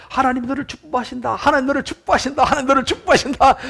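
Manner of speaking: high, repeated imitated echo, not shouted; echo coming back. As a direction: send it back like answering hills. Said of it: "그랬더니 좀 있다 보니까".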